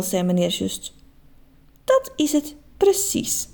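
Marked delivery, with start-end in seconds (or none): 1.8-3.5 high, slow granny; grandma agreeing. A narrator's reading. Grandma agreeing with her grandson, high and slow. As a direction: agree with delight. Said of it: "zei oma Jet".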